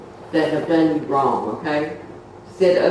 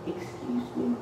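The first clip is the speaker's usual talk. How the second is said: softer and higher "Excuse me."; a second, softer try for attention; even softer; 6 semitones higher, 11 dB quieter than usual. Retold or imitated. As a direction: say "Excuse me." almost under the breath, pitched up. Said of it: "Real soft".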